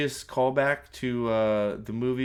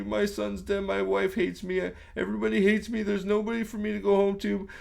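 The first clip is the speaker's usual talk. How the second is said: mock crying; 7 semitones higher, 4 dB louder than usual. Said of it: "Indy cries like a bitch".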